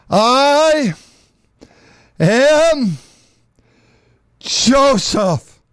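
high, loud, drawn out with long pauses between words; dramatic reveal. Acted. Joseph revealing himself to his brethren, high loud dramatic reveal. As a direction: announce each word as a stunning revelation.

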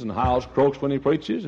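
ordinary delivery

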